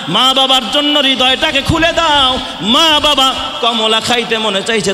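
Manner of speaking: very high, loud impassioned preaching; fiery waz climax; sermon climax. Acted. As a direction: shout it out with all your heart.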